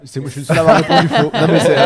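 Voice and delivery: embarrassed laugh at own mistake; laughing, fast and loud. Real, not acted. Player laughing that he alone answered the quiz wrong. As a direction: rush it out through laughter.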